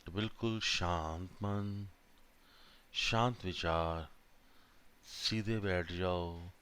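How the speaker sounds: slow, drawn-out meditation voice with long pauses; guided meditation. Real, not acted. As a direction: guide them calmly through each breath, unhurried. Leads a breathing meditation. (धीमी, शांत ध्यान कराने वाली आवाज़)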